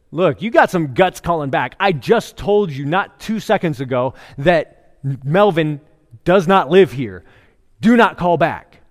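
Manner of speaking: loud, emphatic telling-off down the phone; hammed-up angry phone rebuke; acted anger. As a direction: snap at a repeat caller, punching each word. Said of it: "he's angry. He's not just a little annoyed".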